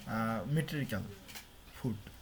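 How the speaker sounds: usual speaking voice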